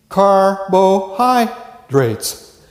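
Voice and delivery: very slow, syllable by syllable carbohydrates; drawn out. Shown as a demonstration. Teacher showing a student its syllables, drawn out. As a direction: stretch out carbohydrates so each of its four beats is heard. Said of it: "You gotta remember four".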